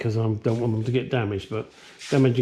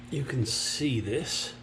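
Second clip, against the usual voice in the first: usual speaking voice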